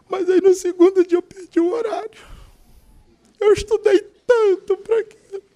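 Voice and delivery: voz bem aguda, chorosa e queixosa; lamento choroso. Retold or imitated. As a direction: imitar alguém que chora o sonho perdido. Fiel imitado lamentando a faculdade. (choramingo agudo imitado)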